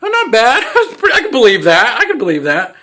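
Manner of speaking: high, loud, laughing mock surprise; impressed surprise. Reacting to the actor's American accent, high laughing surprise. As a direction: squeal it out, pleasantly surprised and laughing. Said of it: "him with an American accent was like".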